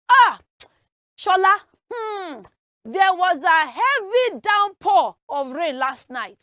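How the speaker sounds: loud, amazed report to a friend; emphatic, loud wrong example; exclaiming to Shola. Acted. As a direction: call it out with big emphasis. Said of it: "It is not heavy downpour".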